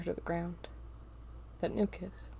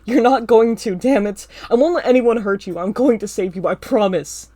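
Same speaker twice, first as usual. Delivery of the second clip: loud fierce promise; 3 semitones higher, 14 dB louder than usual. A narrator's reading. Shouted reassurance.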